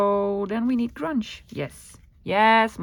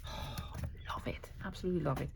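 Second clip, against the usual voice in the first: whisper; 4 semitones lower, 12 dB quieter, faster than usual. Real, not acted. Crafter admiring her work.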